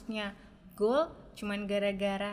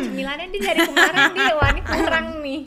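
laughing and loud, higher; 6 semitones higher, 12 dB louder than usual. Real, not acted. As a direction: giggle through a joking complaint. Joking complaint about snacks.

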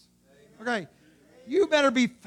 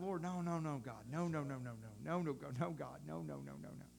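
soft, low, repeated pleading; muted crying out; 8 semitones lower, 18 dB quieter than usual. Acted. Moses crying out to God for the people, hushed pleading. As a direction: plead under your voice, over and over. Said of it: "Moses is crying out".

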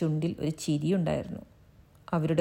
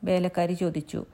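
usual speaking voice

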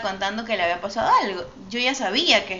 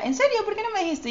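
mock surprise; 11 semitones higher than usual. Friend's surprised reaction retold.

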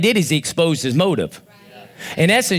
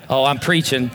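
normal talking voice

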